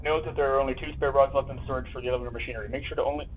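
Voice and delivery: quick, soft read-through; reading a note aloud. Real, not acted. Player reading game instructions aloud, quick soft reading. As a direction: rattle through the note softly.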